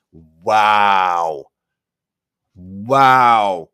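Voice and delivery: long, loud, drawn-out disbelieving wow. Real, not acted. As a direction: stretch the wow out in stunned disbelief. Reacting to pundit's bad take.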